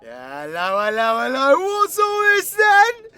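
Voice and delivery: booming comic entrance; mock policeman greeting. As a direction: stretch it out, climbing to a shout.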